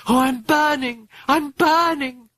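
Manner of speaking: high, drawn-out moan of agony. A narrator's reading. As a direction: wail slowly as if in pain. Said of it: "Regan was moaning".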